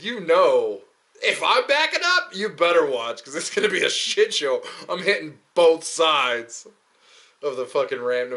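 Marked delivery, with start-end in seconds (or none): 1.2-6.7 loud, high comic alarm; hammed-up warning. Warning onlookers he's backing up, loud comic alarm. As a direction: warn everyone loudly, playing up the disaster.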